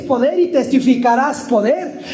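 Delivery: high, urgent pulpit preaching; fervent preaching. Real, not acted. Preacher warning the congregation against drifting from God, fervent preaching.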